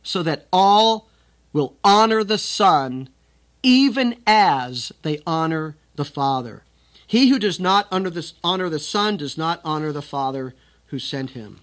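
declaiming scripture to the congregation; forceful scripture reading. Acted. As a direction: preach the text out with weight.

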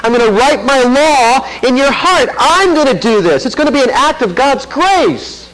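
a lightly voiced God making a firm promise; voicing God's promise. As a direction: proclaim it as God's own pledge. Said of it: "It's exactly what he says".

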